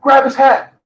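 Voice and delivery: high urgent mock egging-on; lightly voiced girlfriend pushing her man; mocking Phoenix. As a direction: squeal it like she's urging him on. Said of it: "she told Lamar".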